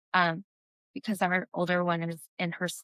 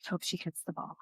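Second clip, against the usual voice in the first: much softer, tense hoping under the breath; anxious mutter; 11 dB quieter, faster than usual. Retold or imitated. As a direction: mutter the hope nervously to yourself. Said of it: "'cause I was like".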